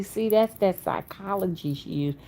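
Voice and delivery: ordinary talk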